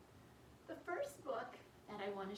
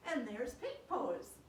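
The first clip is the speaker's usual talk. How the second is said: usual speaking voice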